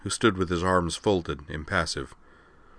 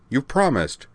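high, insistent reminder; 8 semitones higher than usual. A narrator's reading. Rena holds Amanda to her promise, insistent. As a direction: press it insistently, pitched up. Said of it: "Rena insisted".

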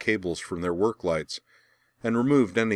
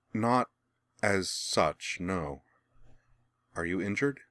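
hesitant; 4 dB quieter, slower than usual. A narrator's reading. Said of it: "she replied hesitantly".

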